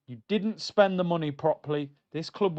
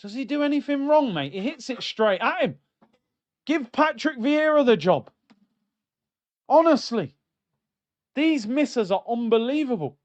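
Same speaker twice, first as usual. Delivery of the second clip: higher, disbelieving outburst; exasperated; 6 semitones higher than usual. Real, not acted. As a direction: howl at the miss in disbelief. Watching Havertz miss replay.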